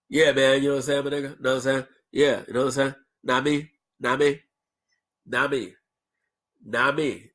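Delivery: "Know what I mean" squashed to nah-mee; slurred street slang. Shown as a demonstration. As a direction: casually slur "Know what I'm saying" to nah'm-san. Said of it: "You reduce it to".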